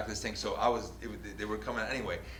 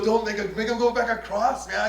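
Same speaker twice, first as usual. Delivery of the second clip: mock plea; 12 semitones higher, 8 dB louder than usual. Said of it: "I go".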